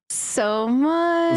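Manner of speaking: high, delighted emphasis; gushing. Real, not acted. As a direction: gush with delight. Gushing agreement.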